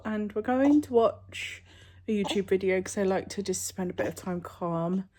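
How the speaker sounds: normal talking voice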